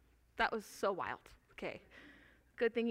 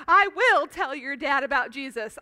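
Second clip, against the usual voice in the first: eager dad; 3 semitones higher, 9 dB louder than usual. Retold or imitated. Dad voiced, eager in story.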